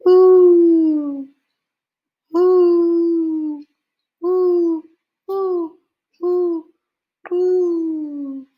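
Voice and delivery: high, loud hooting owl call. Acted. Father calling to the owl in the woods, loud high hoots. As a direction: hoot out long like a great horned owl. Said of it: "The sound of a great horned owl".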